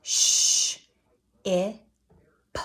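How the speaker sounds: ship split into Sh, I, p; stretched-out word. Shown as a demonstration. Teacher stretches ship into sounds. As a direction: sound out Sh, I, p slowly. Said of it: "stretch out that word with me".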